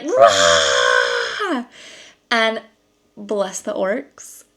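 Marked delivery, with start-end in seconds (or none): 0.0-1.7 loud, drawn-out playful roar; big, silly monster roar; mock roar. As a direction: roar like someone foolishly scaring an orc. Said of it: "be like".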